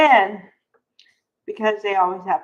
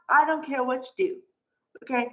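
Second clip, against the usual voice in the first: ordinary delivery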